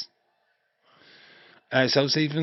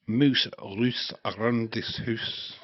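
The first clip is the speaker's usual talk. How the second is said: mock Scottish advert; much slower than usual. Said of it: "and it'd be".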